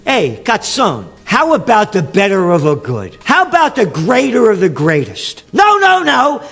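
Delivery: rising heated harangue; heated rant. Real, not acted. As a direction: shout down the preachers of lesser evil. Ranting at priests and voters.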